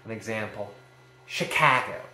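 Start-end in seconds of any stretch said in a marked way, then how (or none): 1.3-2.0 Chicago punched loudly on CA; Chicago with a light start, strong CA, light ending; amphibrach stress. Shown as a demonstration. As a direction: hit the middle syllable of Chicago. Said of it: "So unstressed, stressed, unstressed".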